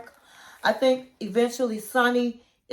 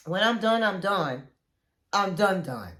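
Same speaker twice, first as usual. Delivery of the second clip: each done lower and softer; emphatic and trailing off; firm, fading finality; 4 semitones lower, 3 dB quieter than usual. Real, not acted. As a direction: say it like a door closing, dying away.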